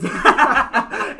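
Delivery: high, loud burst of laughter; big laugh. Real, not acted. Reaction to contract joke.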